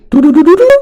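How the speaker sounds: very high sing-song "doo-doo-doo"; rising tune imitation. Shown as a demonstration. Teacher sounds out a normal question's tune, high rising doo-doos. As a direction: mimic a question's rise in quick high nonsense syllables. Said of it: "Unlike normal question, which you go".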